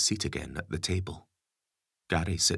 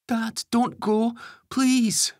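put-on high slow begging young boy; pleading boy; 7 dB louder, much slower than usual. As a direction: beg like a desperate kid. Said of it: "Ben pleads".

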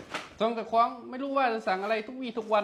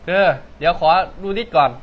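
1 calling out; 3 semitones lower, 9 dB louder than usual. Acted. Customer calls out in shop.

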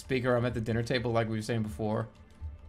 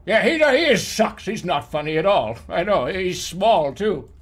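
mocking family chorus; 2 semitones higher, 6 dB louder than usual. Family at the dinner table agreeing he's unfunny, loud mocking voice. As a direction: pile on like a smug relative. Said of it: "you come together as a family".

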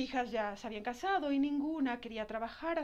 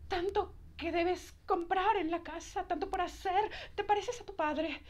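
imitating her mother; 6 semitones higher than usual. Bride imitating her scolding mother about spending money, higher and quick. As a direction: nag her like a disapproving mom. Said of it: "si mi mamá viera".

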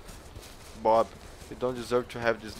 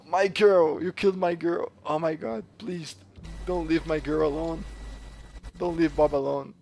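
mock grief; 4 semitones higher, 4 dB louder than usual. Mock mourning killed teammate.

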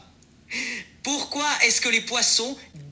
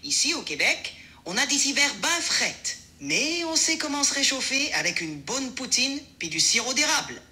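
higher-pitched Québécois French; Québécois accent; 5 semitones higher than usual. Shown as a demonstration. Assistant demonstrates on request, Québécois accent. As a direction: put on a Quebec accent, pitched up. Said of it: "l'accent québécois".

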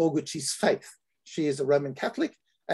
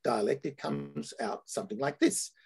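usual speaking voice

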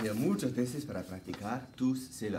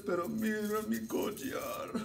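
puppet voice; 9 semitones higher than usual. Acted. Puppet voice aside.

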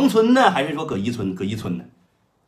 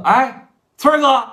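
hearty loud greeting; 10 semitones higher, 7 dB louder, slower than usual. Retold or imitated. Third Brother hails his elder brother on the phone, loud and high.

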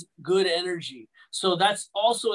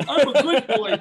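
laughing; 5 semitones higher, 6 dB louder than usual. Real, not acted. Jokey self-praise, repeated laughing.

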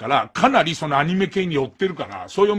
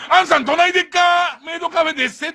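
mock Kansai dialect; 10 semitones higher than usual. Host imagines a maid touting the café to passersby, in a hammed-up Kansai accent. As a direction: do a clumsy outsider's Kansai maid pitch. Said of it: "関西弁の真似した時だけ".

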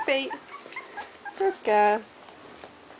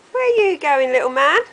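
puppy talk; 3 semitones higher, 9 dB louder, faster than usual. Real, not acted. Mum cooing at a new puppy, loud sing-song. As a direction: coo at the little dog, big and bright.

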